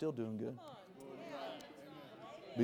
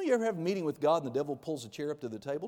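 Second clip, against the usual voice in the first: normal talking voice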